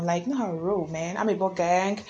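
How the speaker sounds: ordinary delivery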